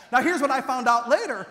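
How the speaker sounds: ordinary talk, nothing marked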